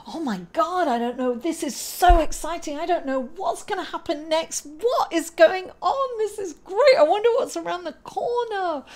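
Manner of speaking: delighted squeal of a wide-eyed beginner; giddy excitement. Pretend. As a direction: gush like a kid who can't wait to see what's next. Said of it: "asked to be excited about these changes".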